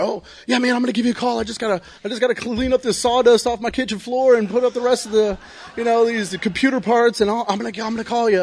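high, fast, hyped-up tweaker promising to call; jittery high voice full of empty promises; mocking his own high voice. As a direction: rattle it off high and fast like a wired addict making excuses.

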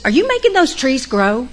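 high, loud pointed question; stern counselor. Retold or imitated. Counselor quizzing Joe about the trees, pointed and loud. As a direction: pin her down with the question. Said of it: "And she said".

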